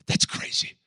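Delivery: breathless whisper of amazement; voiceless awe; whispered aside. Acted. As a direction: hush it like a secret. Preacher repeats phrase later.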